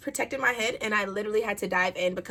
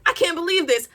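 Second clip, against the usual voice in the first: shocked; 7 semitones higher, 7 dB louder than usual. Retold or imitated. Winded under the boat, panicking.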